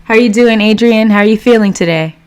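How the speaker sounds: excited greeting. Real, not acted. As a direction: hail a regular like a show host. Greets another returning livestream viewer.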